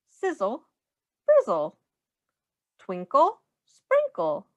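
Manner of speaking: bouncy rhyming storybook voice, swinging up and down; sing-song read-aloud. Acted. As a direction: read it like a playful rhyme for a small child. Reading rhyming picture book aloud.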